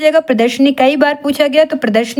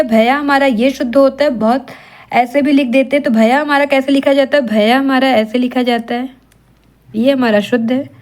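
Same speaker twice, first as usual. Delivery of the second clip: ordinary talk, nothing marked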